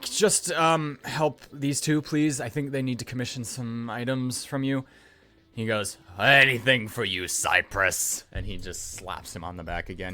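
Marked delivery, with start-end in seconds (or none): 0.0-4.8 high, halting request; rattled elf. Acted. Elf just swatted asks a favor, rattled. Cypress after being knocked across counter. 6.2-8.2 loud, hearty assurance; booming joviality. Acted. Smith grants Cypress his favor, booming.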